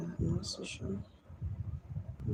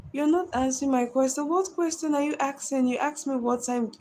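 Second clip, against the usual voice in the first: raised, louder, indignant pushback; indignant protest; 8 semitones higher, 7 dB louder than usual. Real, not acted. To a viewer who keeps asking, indignant and louder. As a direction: call out the viewer for nagging.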